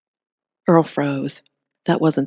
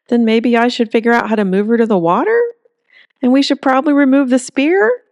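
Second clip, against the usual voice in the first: rising, higher questions, asking not telling; unsure questioning; 9 semitones higher, 4 dB louder than usual. A narrator's reading. Earl unsure of his plan before Holly, tentative questions. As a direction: hedge every idea upward. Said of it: "Are you asking me questions or telling me a plan?".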